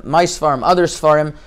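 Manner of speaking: ordinary talk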